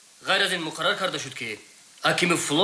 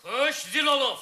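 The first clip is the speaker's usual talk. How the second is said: urgent calming; 9 semitones higher than usual. Acted. Second officer urges calm, high.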